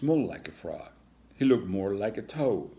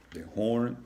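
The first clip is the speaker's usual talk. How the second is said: ordinary delivery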